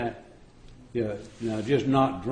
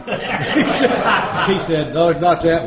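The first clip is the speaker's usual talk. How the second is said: laughing; 6 semitones higher, 7 dB louder, slower than usual. Real, not acted. Retelling officer mix-up, amused.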